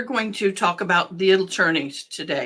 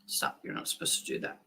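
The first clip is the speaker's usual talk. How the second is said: whispering; 13 dB quieter, faster than usual. Real, not acted. Aside whisper off mic.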